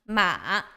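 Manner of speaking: MA dipping, then rising; low dipping tone on MA; third tone. Shown as a demonstration. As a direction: take "ma" down and back up. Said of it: "you go down and go up".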